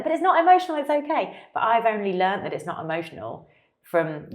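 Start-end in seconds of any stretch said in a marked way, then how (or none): 0.0-1.4 high, mock-soothing voice; mock reassurance. Coach reassuring a crying lifter in a high mock voice. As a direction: imitate the coach reassuring, light parody. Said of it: "And then you're like".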